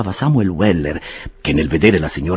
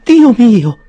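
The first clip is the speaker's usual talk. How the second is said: very high, louder startled cry; startled exclamation; 10 dB louder than usual. A narrator's reading. Mrs Bardell startled by the summons, high cry. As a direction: jump at the news. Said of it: "esclamò la signora sobbalzando".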